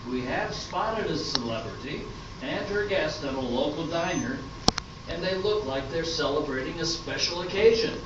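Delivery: soft, hushed announcer read; radio announcer. Acted. Announcer whispering to listeners about spying on a celebrity. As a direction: introduce the show like an old radio host.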